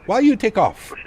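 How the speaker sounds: put-on high, loud Italian controller accent; mock Italian accent. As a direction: ham up an Italian air traffic controller. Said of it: "In a nice Italian accent".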